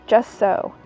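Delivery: plain reading voice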